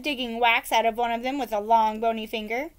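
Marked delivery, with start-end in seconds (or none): none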